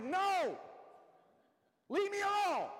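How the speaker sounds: high, loud, fed-up "leave me alone"; exasperated shout. Retold or imitated. Worn-out parent yelling at nagging kids to stop. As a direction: snap at the kids to back off, shouting. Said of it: "you're like".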